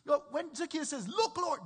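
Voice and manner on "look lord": excited. Retold or imitated. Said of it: "do you hear the excitement?".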